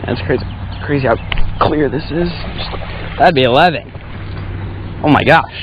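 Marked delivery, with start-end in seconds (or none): none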